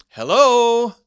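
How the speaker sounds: loud, high hello held long; loud sharp hello. Shown as a demonstration. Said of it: "Different tone".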